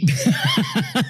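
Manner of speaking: shrieking laugh; high burst of laughter. Real, not acted. Host laughs hard.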